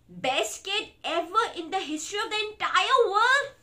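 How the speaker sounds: high, loud hyperbole; gushing praise. Acted. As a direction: build up the grand praise. Book forces gushing praise.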